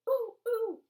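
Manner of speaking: bursting to be called on; eager groan. Acted. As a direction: kid desperate to answer. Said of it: "Mac groaned".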